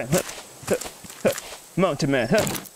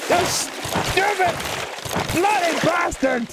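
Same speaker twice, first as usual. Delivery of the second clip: high, drawn-out Irish cursing; mock-angry Irish yell; Irish accent; 9 semitones higher, much slower than usual. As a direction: curse it out as an outraged Irishman. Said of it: "speaking like an Irishman".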